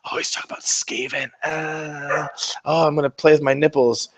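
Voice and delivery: imitating a gushing Skaven fan, swooning over rats; hammed-up fanboy gushing, rising to a squeal; mock excited Skaven player. Mocking Skaven players: gushing fanboy voice. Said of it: "Are you like".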